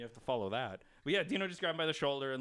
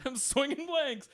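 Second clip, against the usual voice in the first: higher, slow, laughing as he quotes it; mock the heckler's line through laughter; 12 semitones higher, 4 dB louder, slower than usual. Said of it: "Yeah, I'm like".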